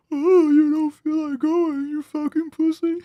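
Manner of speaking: high, slow mocking whine; mock him with a whiny taunt. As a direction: Amelia mocking a friend who skips the gym. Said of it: "Amelia turns and goes".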